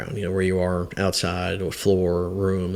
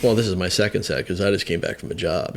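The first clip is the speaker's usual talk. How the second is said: ordinary talk, nothing marked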